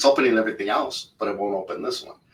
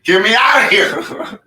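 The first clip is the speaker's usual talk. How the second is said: loud, slow, pleading cry; mock desperate shout; 12 semitones higher, 10 dB louder than usual. Stuck in the locker room, begging the attendant, shouting. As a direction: beg at the top of your voice.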